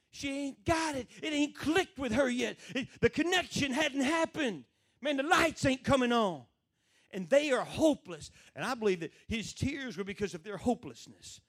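high, loud, emphatic sermon delivery; full-force preaching. Real, not acted. Preacher explains why Jesus wept, full-force preaching.

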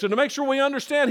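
ordinary delivery

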